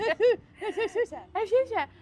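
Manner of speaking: very high, very slow babbled shusha in a French lilt; hammed-up French baby. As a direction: coo it like an overdone French baby. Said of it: "My little baby M C is French now".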